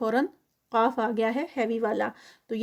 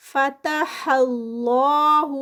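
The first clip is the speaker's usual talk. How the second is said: slow drawn-out tajweed recitation, vowels held; Quran recitation; 5 dB louder, slower than usual. Shown as a demonstration. Tajweed teacher reciting a verse for students, slow melodic recitation. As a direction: chant each word with its lengthening. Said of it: "ٹو counts کا stretch".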